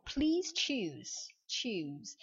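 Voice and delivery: "choose" drawn out slowly with tch and z; final z. Shown as a demonstration. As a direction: stretch "choose" to a buzzing z. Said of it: "ça se prononce". (« choose » lent, avec tch et z final)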